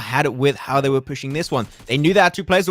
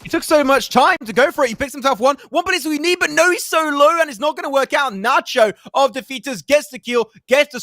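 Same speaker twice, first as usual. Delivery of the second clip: excited; 11 semitones higher than usual. Real, not acted. Caster reacting to a clutch fight.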